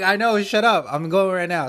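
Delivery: loud, high, panicked "I know, shut up"; frantic snap. Retold or imitated. To his wife while rushing to the restaurant, panicked loud snap. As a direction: cut her off and bolt out the door.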